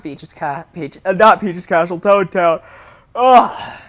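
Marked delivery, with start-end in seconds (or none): 3.1-3.8 frustrated groan. Real, not acted. Frustrated at own mix-up.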